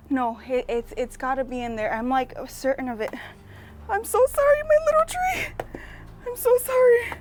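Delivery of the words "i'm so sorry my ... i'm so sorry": much higher, louder squeaky apology; sorry to tree. Acted. Gardener apologizing to her little tree before digging, squeaky. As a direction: plead with the little tree in a tiny, high, loud voice.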